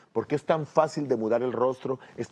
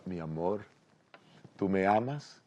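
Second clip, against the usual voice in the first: soft, low loving murmur; tender; 5 semitones lower, 7 dB quieter than usual. Acted. Husband hugging wife at the dishes, tender. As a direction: ask gently, close to her ear. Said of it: "le dice al oído con ternura".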